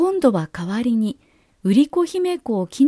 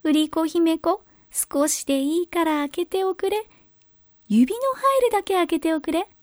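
fake cute pouty voice; 5 semitones higher than usual. A narrator's reading. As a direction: coax her to open the door. Ogre coaxing at the door.

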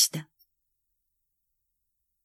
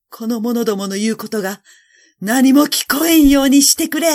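building to a high, loud plea; desperate loud order; 4 semitones higher, 8 dB louder than usual. A narrator's reading. Emperor ordering the faces drowned out, desperate shout. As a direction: cry it out to drown the voices. Emperor pleading over the voices.

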